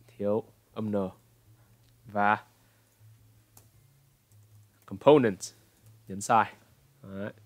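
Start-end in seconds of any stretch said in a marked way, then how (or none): 4.9-5.4 component punched on the middle syllable; com-PO-nent, loud falling middle; stress on PO. Shown as a demonstration. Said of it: "Bạn nhấn vào đâu?". (component, nhấn vào âm "po")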